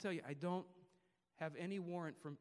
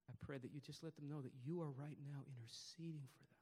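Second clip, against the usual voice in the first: prayer. Real, not acted. Closing prayer.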